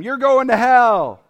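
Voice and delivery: drawn-out, high, very loud yell; brash yelling. Shown as a demonstration. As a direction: yell it at them, stretching it out. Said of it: "Brash would be yelling at them".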